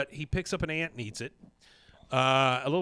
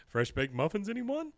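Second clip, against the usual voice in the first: offering muffins; 8 semitones higher than usual. Retold or imitated. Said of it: "and he says".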